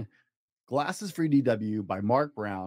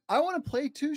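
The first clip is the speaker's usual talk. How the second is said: put-on much higher childlike plea; whiny little girl; 13 semitones higher than usual. Little sister begging to play, high childlike plea. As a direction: beg to join like a small kid. D W pleading.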